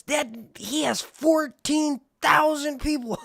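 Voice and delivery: high, loud, slow disbelief; stunned at the number. Real, not acted. Astonished at follower count.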